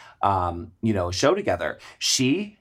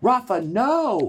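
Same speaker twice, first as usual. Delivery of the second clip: even higher, slow desperate no; alarmed cry; 10 semitones higher, slower than usual. Retold or imitated. Wife repeats her plea to the husband, higher and drawn out. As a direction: plead again, higher still, stretched out. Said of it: "And, and Coco goes".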